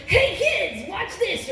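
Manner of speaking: bright, high cartoon host; peppy kids show presenter. Shown as a demonstration. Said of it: "trying to sound like, you know those kids' cartoons".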